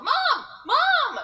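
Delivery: put-on high, loud child's call; yell. Lost girls spotting their mother down the street, yelling. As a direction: yell out desperately for a parent. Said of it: "And we yell".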